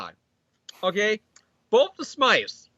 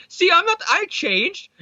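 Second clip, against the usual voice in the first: mock pleading whine; 11 semitones higher, slower than usual. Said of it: "as I asked five thousand different clones".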